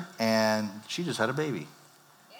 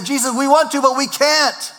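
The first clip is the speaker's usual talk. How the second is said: much higher, louder pleading whine; whiny excuse; 12 semitones higher, 7 dB louder than usual. Acted. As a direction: beg off like a helpless child. Voicing whining believers to Jesus.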